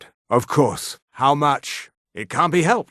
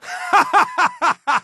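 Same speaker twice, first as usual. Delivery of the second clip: high, staccato gloating laugh; villain laugh; 12 semitones higher than usual. Acted. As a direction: laugh triumphantly at the foe.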